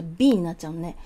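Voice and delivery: ordinary talk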